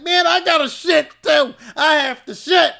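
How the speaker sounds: high, loud, pleading voice; desperate whine. Retold or imitated. Scarborough pleading with Ramin for the toilet, loud high whine. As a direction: beg like you're about to burst. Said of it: "Scarborough was like".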